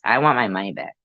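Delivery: usual speaking voice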